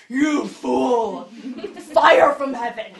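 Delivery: loud, thundering parody of divine wrath, breaking into laughter; mock wrathful God. Pastor jokes about what God didn't do, mock wrath. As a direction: boom out a mock smiting through a laugh. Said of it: "And God didn't go".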